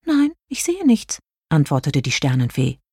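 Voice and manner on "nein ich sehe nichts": high-pitched fairy reply; light, high character voice; star fairy voice. A narrator's reading. Narrator voices Jelina.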